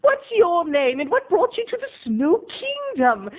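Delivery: put-on sugary mascot asking a child's name; Snow King voice. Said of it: "I had to be like".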